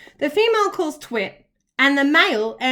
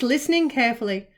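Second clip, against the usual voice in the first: ordinary delivery, nothing marked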